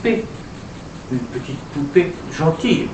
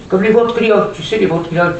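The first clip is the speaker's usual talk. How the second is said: higher, louder doll voice; puppet voice; 6 semitones higher, 5 dB louder, faster than usual. Acted. Puppet Julie naming ventriloquists, high doll voice. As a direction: pipe up as the puppet.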